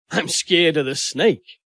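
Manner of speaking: ordinary delivery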